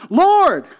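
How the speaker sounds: high, loud cry of "Lord!"; top-of-lungs exclamation. Shown as a demonstration. Someone coming up to Jesus emphatically, exclaiming loudly. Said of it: "top of his lungs".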